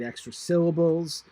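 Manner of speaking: ordinary talk, nothing marked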